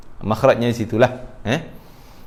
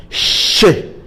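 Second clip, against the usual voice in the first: hushing hiss, tongue raised to the palate; sh friction sound; 4 semitones higher than usual. Shown as a demonstration. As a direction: demonstrate the shh. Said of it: "lidah kita naik ke atas". (desis "sy" lidah dinaikkan)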